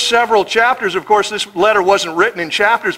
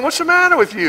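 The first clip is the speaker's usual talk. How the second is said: exasperated Paul; 10 semitones higher, faster than usual. Retold or imitated. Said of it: "Paul is saying".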